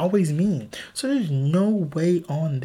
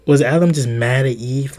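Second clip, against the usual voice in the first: ordinary talk, nothing marked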